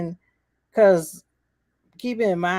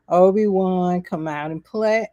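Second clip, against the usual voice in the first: taunting villain; 5 dB louder than usual. Retold or imitated. Reva sensing Obi-Wan nearby, sing-song taunt. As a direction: lure someone out of hiding, playfully menacing.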